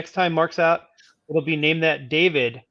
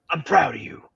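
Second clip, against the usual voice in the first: whisper. Acted. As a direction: whisper it like a secret. Recurring proud-of-you bit.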